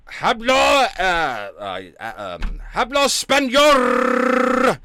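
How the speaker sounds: loud, high mock Spanish with a long rolled R. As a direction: shout it in bad, showy Spanish.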